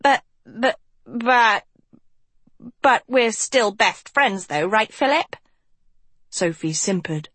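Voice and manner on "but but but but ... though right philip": put-on higher, nervous stammering boy; stammering Hort. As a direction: plead through a forced smile. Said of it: "forced a smile".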